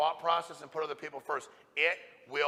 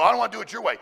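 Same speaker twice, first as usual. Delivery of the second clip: loud, higher mock whine of a reluctant agent; whiny mock objection; 6 semitones higher, 10 dB louder, much faster than usual. Coach mocking an agent who resists his way, loud mock whine. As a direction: throw the agent's excuse back, mocking and loud.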